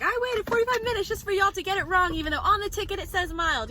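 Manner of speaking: high, entitled complaining customer; Karen voice. Retold or imitated. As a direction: play the demanding customer. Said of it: "like a Karen being like".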